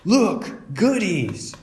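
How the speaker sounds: put-on a boy spotting treats, eager; excited Brother. Brother spotting the groceries, excited.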